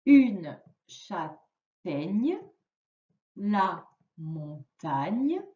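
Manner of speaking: word by word, drawn out with long pauses; very slow, deliberate word list for a learner to follow; slow reading aloud. Shown as a demonstration. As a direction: model careful reading for a beginner, stretching each syllable. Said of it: "on commence la lecture".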